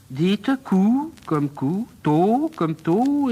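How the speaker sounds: slow, drawn-out syllable drill; teacher slowly dictating sounds; insistent drilling. Acted. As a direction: draw out each syllable for a pupil.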